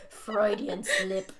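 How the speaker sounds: laughing out the words; words squeezed out through laughter. Real, not acted.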